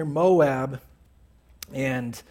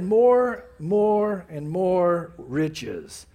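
more, more, more, each one hit, the last drawn out slow; stress on more; 3 dB louder, much slower than usual. Acted. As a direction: lean hard on each "more", slowing on the final "more". Preacher stressing greed.

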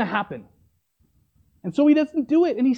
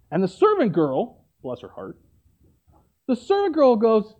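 ordinary talk, nothing marked

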